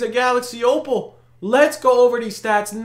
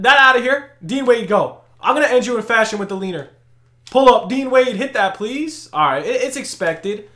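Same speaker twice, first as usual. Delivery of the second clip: ordinary talk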